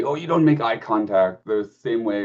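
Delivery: ordinary delivery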